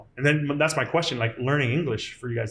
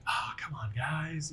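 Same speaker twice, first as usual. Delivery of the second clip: soft sigh; 11 dB quieter, slower than usual. Retold or imitated. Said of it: "I'm just like".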